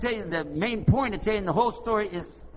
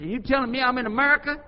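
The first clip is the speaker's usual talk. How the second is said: loud, high incredulous question; hollering; 9 semitones higher, 7 dB louder than usual. Retold or imitated. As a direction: holler it back as a challenge. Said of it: "I hollered at the guy".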